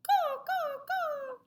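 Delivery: very high cawing crow voice. Acted. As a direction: squawk it like a cheeky crow. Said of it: "crow just replies".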